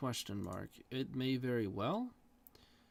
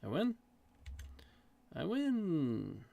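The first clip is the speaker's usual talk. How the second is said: surprised; 5 semitones higher, 3 dB louder, slower than usual. Real, not acted. Surprised at winning.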